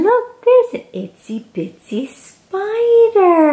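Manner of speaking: high excited pointing-out; delighted. Acted. Showing the child the picture, delighted. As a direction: spot it with wonder.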